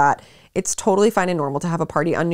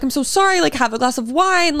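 put-on apologetic drunk; 8 semitones higher than usual. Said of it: "I think I'm like".

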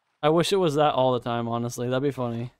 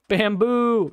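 higher, louder happy cry of bamboo; excited shout; 7 semitones higher, 5 dB louder than usual. Real, not acted. Spotting bamboo, an excited shout. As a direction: cheer at the find.

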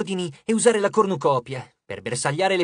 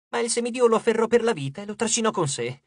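ordinary delivery, nothing marked

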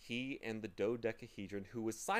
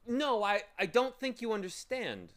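a lightly voiced timid boy, higher; 12 semitones higher, 7 dB louder than usual. Boy answering the bird, lightly timid. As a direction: protest meekly to the bird. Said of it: "said Milo timidly".

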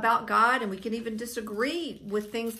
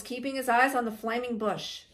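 usual speaking voice

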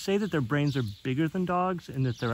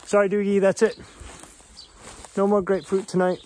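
louder, higher firm no to the pet; firm refusal; 7 semitones higher, 5 dB louder than usual. Real, not acted. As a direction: set a firm limit for the pig. Firmly refusing the pig.

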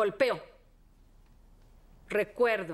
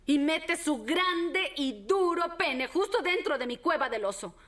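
ordinary delivery

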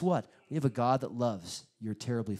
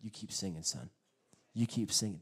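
soft, lower, gentle coaxing; encouraging dad; 6 semitones lower, 4 dB quieter than usual. Retold or imitated. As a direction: tell your son to keep going, softly. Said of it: "as a father, I'm like".

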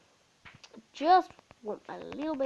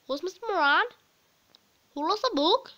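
confused student; 8 semitones higher, 6 dB louder than usual. Pretend. Pretend student questions sign.

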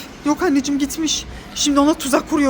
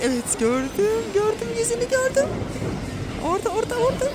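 rising high calls; mother spotting her hiding daughter; excited spotting; 8 semitones higher than usual. Pretend. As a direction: point her out gleefully. Hide and seek spotting.

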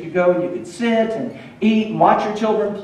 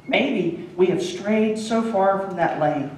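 normal talking voice

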